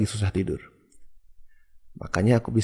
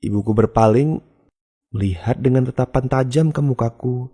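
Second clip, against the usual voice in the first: ordinary delivery, nothing marked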